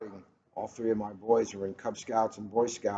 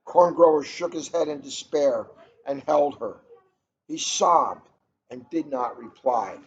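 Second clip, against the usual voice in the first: measured storybook reading, a bit brighter and louder than chat; story narration; 4 semitones higher, 6 dB louder than usual. A narrator's reading. Host reading a picture book to listeners, storybook narration. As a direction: read the tale aloud to listeners, carefully paced.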